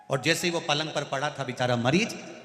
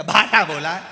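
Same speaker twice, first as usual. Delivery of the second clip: angry loud answer; 3 semitones higher, 13 dB louder than usual. Retold or imitated.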